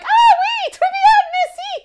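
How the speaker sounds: very high, loud, laughing French politeness; squeaky nervous French. Retold or imitated. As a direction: squeak it out in panic. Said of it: "And we were like".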